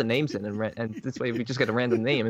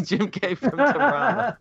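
laughing; 8 semitones higher, 5 dB louder than usual. Real, not acted. Laughing at fake name.

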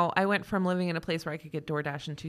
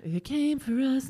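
slow, higher spooky announcement; spooky title read; 5 semitones higher, slower than usual. Acted. Repeating the title dramatically.

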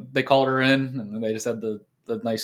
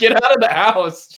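high, loud hammed-up shout; mock yell; 8 semitones higher, 5 dB louder than usual. Father kicking his son out, mock furious yell. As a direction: throw him out, roaring. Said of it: "he just goes".

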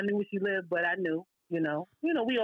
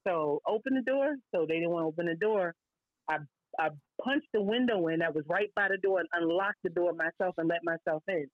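usual speaking voice

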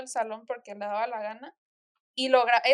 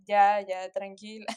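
imitated soothing; 4 semitones lower, slower than usual. Classmates calming her as she cries, low and soothing. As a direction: drop low and hush to console. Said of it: "y así de que".

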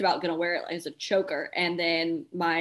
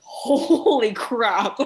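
slow, stunned and louder, pitch up; 4 semitones higher, 7 dB louder, much slower than usual. Retold or imitated. Pageant finalist stunned at not hearing her name, slow disbelief. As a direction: draw out the shock. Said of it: "And that moment I was like".

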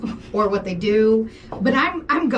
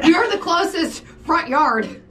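mock pride; 7 semitones higher, 4 dB louder than usual. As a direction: crow about it like it's a prize. Mocking the parking guy.

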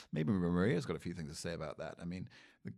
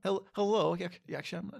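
put-on loud, high-pitched Borat-style foreign accent; mock Borat accent; 10 semitones higher, 6 dB louder, slower than usual. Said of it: "No, that's Borat, mate".

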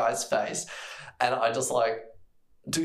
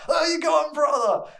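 much higher, louder hail; mock attendant shout; 8 dB louder than usual. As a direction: call out to the newcomer. Attendant greets the friend.

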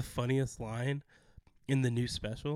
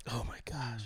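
whisper; 6 dB quieter than usual. Real, not acted. Whisper after laughing.

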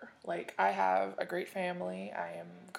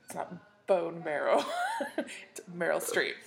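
amused, laughing tease; laughing correction; 6 dB louder than usual. Real, not acted. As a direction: laugh while correcting a friend's slip. Laughing correction of co-host's slip.

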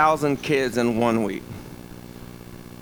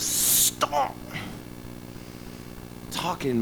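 unvoiced whisper, much softer; 10 dB quieter, much slower than usual. Shown as a demonstration. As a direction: breathe it with no voice. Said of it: "like I'm whispering".

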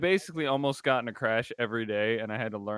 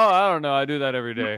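mock casual; 3 semitones higher, 12 dB louder, faster than usual. Casual brag reply, retold loudly.

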